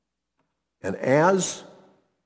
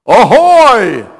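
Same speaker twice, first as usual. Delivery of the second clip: a big hearty sailor's shout, much louder and higher; mock manly call; 8 semitones higher, 13 dB louder than usual. Preacher hams up a sailor's call before rejecting it, loud hearty hail. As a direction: bellow it like a hearty deckhand hailing a ship. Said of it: "No, no manly call here".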